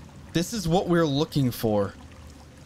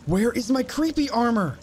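raised pitch, frustrated question; exasperated demand; 7 semitones higher than usual. Real, not acted. Player hunting for missing creepy armor, exasperated demand.

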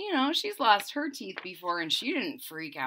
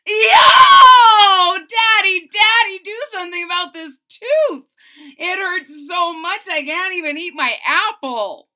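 high, loud pained yell; 9 semitones higher, 14 dB louder than usual. A narrator's reading. Boy yells to his father about his aching tooth. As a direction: holler for Daddy to fix it. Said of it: "and yelled".

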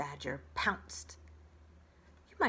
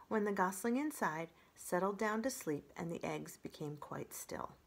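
ordinary delivery, nothing marked

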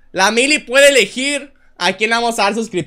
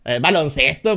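Latin American dub voice; 8 semitones lower, 4 dB quieter than usual. Retold or imitated.

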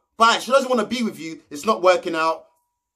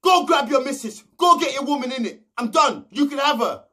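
mock angry shout; 5 semitones higher than usual. Ovi storming off, shouting at Jordan. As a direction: yell it like storming off. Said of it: "Ovi got mad".

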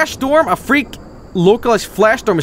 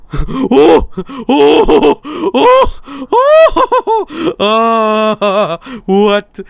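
shocked gasps; 8 semitones higher, 4 dB louder, much slower than usual. Real, not acted. Sudden disaster in the game.

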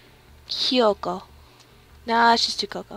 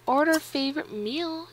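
ordinary delivery

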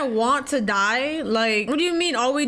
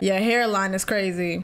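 ordinary talk, nothing marked

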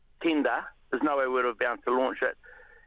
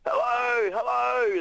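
shouting hello; 8 semitones higher, much slower than usual. Retold or imitated. Shipwrecked sailor calling to fishermen, raised hello. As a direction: call out to distant fishermen. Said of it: "I was shouting".

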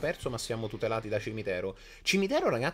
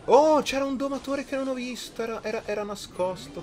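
sudden high surprised cry; startled gamer outburst; surprised shout; 12 semitones higher, 7 dB louder than usual. Real, not acted. Gamer spots hidden enemy card.

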